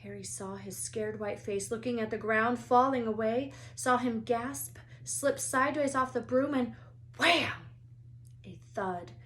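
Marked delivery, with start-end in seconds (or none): none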